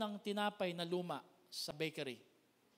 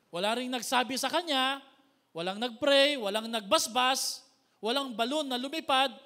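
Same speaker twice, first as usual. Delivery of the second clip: loud fervent listing; preaching at full force; 5 semitones higher, 11 dB louder than usual. Acted. Preacher listing what Lazarus never got, loud. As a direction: hammer each line home.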